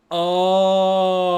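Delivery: long drawn-out loud "oh" of realisation; dawning realisation. Retold or imitated. Rewatcher finally understanding the game's story, dawning realisation. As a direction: act out the penny dropping. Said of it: "and be like".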